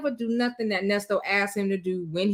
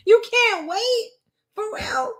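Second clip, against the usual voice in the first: very high, drawn-out disbelief; incredulous; 12 semitones higher, slower than usual. Real, not acted. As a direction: question it slowly, pitched way up. Mocking the caller's late-night request.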